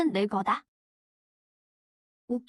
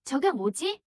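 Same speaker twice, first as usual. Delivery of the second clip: usual speaking voice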